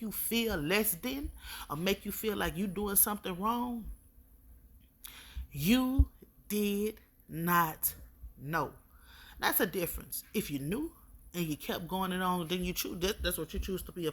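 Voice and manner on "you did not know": you did not know, each word drawn out slowly and pressed; slow stress on did not know. Real, not acted. To the hurting woman, you did not know said slow and pressed.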